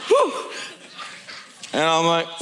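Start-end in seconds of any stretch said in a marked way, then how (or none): 0.1-0.5 short high whooped exhale; high "whew". Acted. Preacher recovering from friend's rant, high whew.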